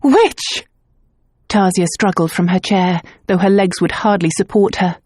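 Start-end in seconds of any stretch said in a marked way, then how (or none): 0.0-0.7 much higher sharp accusation; accusing shriek. Acted. Clairvoyant shrieking at the governess, accusing. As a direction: hurl the word at her.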